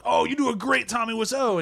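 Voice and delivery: high-pitched retold burst of praise; mock gushing. Acting out own reaction of praise.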